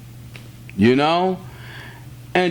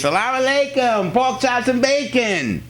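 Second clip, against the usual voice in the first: singsong jeer, pitched up; sneering playground taunt, raised voice; mocking taunt; 8 semitones higher than usual. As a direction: tease him like the project kids did.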